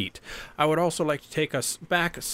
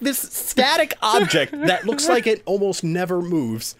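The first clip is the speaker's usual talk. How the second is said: excited; 9 semitones higher, 5 dB louder than usual. Real, not acted. Excited retelling of scene.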